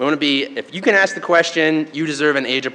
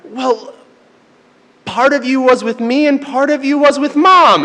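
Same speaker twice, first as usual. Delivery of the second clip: higher-pitched improvised reassurance, retold; reaching for nothing; 9 semitones higher, 4 dB louder than usual. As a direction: make it up as you go, voice pitched up. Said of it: "I kinda reaching for nothing".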